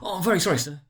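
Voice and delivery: a flustered clerk begging pardon; nervous clerk. Acted. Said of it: "said Bob".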